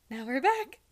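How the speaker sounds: high, loud hammed-up announcer; mock announcer. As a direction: belt it like a cheesy host. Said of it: "Just like".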